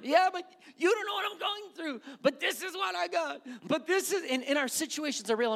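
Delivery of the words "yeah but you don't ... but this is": high, whiny voice of a stressed churchgoer; mocking a worried believer. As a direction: play the fretting listener, much higher. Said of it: "I'm making, making fun of you".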